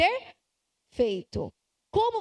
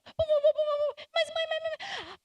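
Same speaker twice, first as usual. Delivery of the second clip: put-on high, nagging little-kid voice; child whining mãe over and over, much higher; whiny child; 14 semitones higher, much slower than usual. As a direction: pester mum like a spoilt child. Child insisting during a tantrum.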